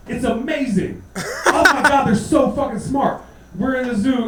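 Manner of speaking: usual speaking voice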